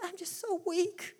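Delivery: soft, slow, feeble little voice; mock weak whimper. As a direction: sigh it out like you have given up. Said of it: "you said".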